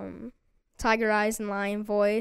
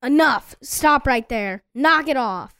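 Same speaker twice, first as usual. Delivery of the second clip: loud, bold commands; firm, forceful warning, falling at the end; lion voice; 3 semitones higher, 9 dB louder than usual. Shown as a demonstration. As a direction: bark it out with courage. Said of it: "with your lion voice".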